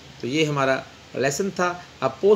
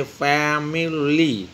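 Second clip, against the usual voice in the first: Family slowly split as fa, mi, lee; syllable by syllable; much slower than usual. Shown as a demonstration. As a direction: stretch family into three separate syllables. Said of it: "तीन इसके होंगे". (Family کو تین حصوں میں آہستہ توڑ کر بولیں)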